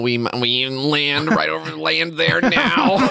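alien voice. Acted. Voicing aliens landing.

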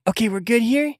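high character voice; businesslike instructions, pitched well up; [name]. Acted. Helper on a shoulder giving next steps, high character voice. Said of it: "and says".

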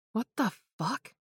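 hushed, high shocked exclamation; shocked gasp. Acted. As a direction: gasp it out in disbelief. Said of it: "Chantel gasped".

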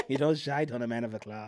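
amused attempt at an Irish lilt; mock Irish accent. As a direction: echo it in a put-on Irish accent. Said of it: "Can you do Irish accent?".

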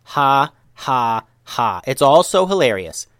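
separate, deliberate ha's, unamused; sarcastic mock laugh. Pied ninja answers the others' laughter, sarcastic. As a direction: fake the laugh, then complain loudly.

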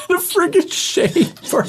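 high squealing laugh; laughing shout. Real, not acted. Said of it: "laughing outburst".

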